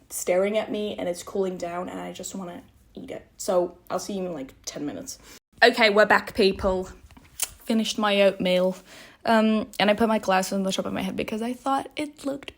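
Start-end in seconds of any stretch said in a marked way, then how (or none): none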